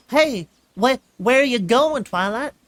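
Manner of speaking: high, loud call after a friend; filly calling after. A narrator's reading. Filly trotting after Twilight, calling out. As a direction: call out as you hurry to catch up.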